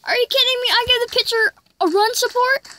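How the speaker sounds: high, outraged complaining; indignant disbelief. Real, not acted. Gamer fuming at his pitcher who blew the lead, high indignant rant. Pitcher gives up four runs.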